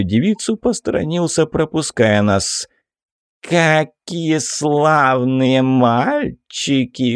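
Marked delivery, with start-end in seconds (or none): 3.4-7.2 slow, drawn-out mincing coo; sassy drag queen. Acted. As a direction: stretch it out like a camp diva. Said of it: "протянул он".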